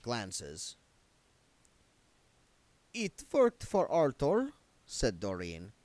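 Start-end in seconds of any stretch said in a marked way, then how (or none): 2.9-4.5 high, slow, affected countess voice; a lofty vampire countess, drawn out; Doreen's voice. A narrator's reading. Doreen's character voice.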